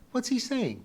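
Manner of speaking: put-on high-pitched, insistent girl; demanding. A narrator's reading. Pee-wee wanting the Latin translated, demanding. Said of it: "demanded Pee-wee".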